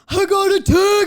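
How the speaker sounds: high, slow, loud announcement; excited shout. Shown as a demonstration. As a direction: shout the text news. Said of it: "This would be me on the show".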